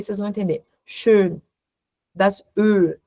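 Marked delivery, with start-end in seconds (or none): 0.8-1.4 Schön with rounded Ö, lips closed; German Schön. Shown as a demonstration. German teacher reading Schön aloud for the class. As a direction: say Schön with an O through pursed lips. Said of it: "Então, vou ler".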